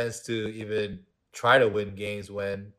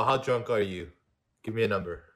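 ordinary talk, nothing marked

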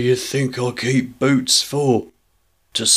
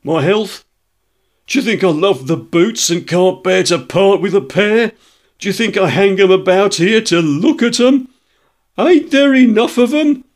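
indignant shopkeeper building to a high, loud rant; furious tradesman tirade, climbing ever higher and louder; growing angrier; 6 dB louder than usual. A narrator's reading. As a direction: escalate from affronted questions to shrieking outrage. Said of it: "grow more angry as they proceed".

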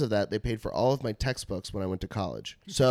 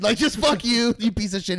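higher and louder, hammed-up fury; mock angry shout; 10 semitones higher, 6 dB louder than usual. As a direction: let the mock rage boil over. Mock yelling at stoned friend.

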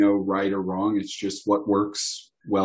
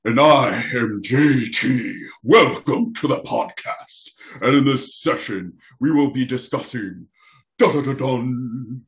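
grand announcer voice; 3 semitones higher, 4 dB louder than usual. Acted. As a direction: announce it like an epic trailer. Playful intro matching divine voice.

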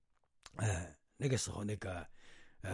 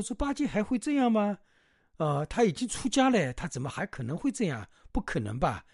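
much higher, disbelieving master; can't believe it of him; doubtful surprise; 8 semitones higher, 5 dB louder than usual. Retold or imitated. Tang monk doubting Wukong's report about Bajie, incredulous.

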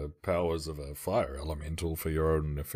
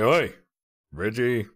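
loud shout across the tavern; hollered greeting to the barkeep; calling out; 5 semitones higher, 6 dB louder than usual. Acted. Player calls out to Reginald from a bench, loud. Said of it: "and say".

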